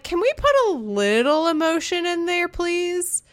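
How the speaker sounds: mock plea, voice lifted high; imagined plea. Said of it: "And I'm like".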